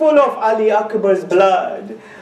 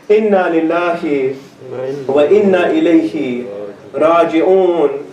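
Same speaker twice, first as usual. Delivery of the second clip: solemn recitation; 9 semitones lower than usual. Acted. Preacher mourning the slain son, low solemn recitation. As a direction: intone it like a prayer for the dead.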